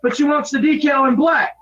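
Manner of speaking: angry shouting. Real, not acted. Frustrated worker yells at coworker.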